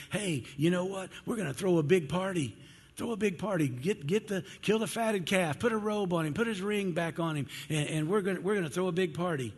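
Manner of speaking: high, elated rush of orders; excited dad. Acted. Father welcoming the prodigal son home, all excited. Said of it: "he gets all excited and he says".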